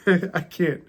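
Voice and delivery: words breaking up in real laughter; can't keep a straight face; laughing. Real, not acted. Reading a hateful passage aloud, cracking up with laughter. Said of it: "I can't even say this with a straight face".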